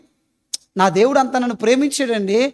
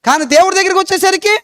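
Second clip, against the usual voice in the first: ordinary talk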